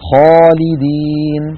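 خالدين with a heavy, velarized alif after the خ. Shown as a demonstration. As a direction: stretch out خالدين, alif full and dark. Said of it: "لاحظوا الخاء والألف التي بعدها". (ألف مفخمة)

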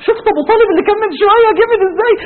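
real tears while speaking; crying. Real, not acted. Preacher moved by Abu Talib's poem, voice cracking with tears. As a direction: voice breaking into tears, high.

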